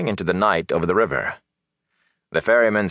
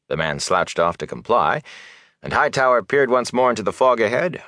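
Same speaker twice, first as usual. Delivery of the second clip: usual speaking voice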